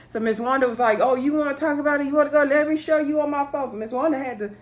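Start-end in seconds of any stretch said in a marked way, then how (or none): 1.0-3.7 quick, raised-pitch retold dare; mock challenge. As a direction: imitate someone squaring up for a quarrel, higher and quicker.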